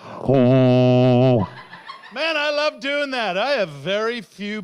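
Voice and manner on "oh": deep, loud ship's horn; foghorn imitation. Shown as a demonstration. Comedian sounds a ship's horn at maritime people, booming foghorn. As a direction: blast out a long low foghorn.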